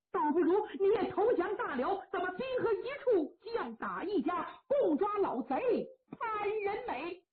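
high, pressing, then slowing on the villain's name; urgent coaxing pitch; eager persuasion. Acted. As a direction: talk him into it with rising zeal. Turncoat urges surrender.

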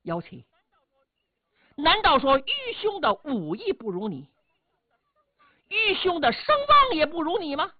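offended, demanding an answer; rising to an affronted question; angry elder brother. Acted. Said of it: "贾父生气了".

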